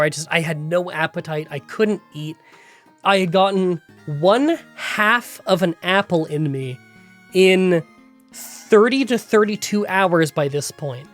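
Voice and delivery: ordinary delivery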